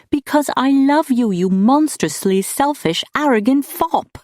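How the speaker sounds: high, impassioned shout; loud, high outburst at a lover. A narrator's reading. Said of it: "she shouted at him".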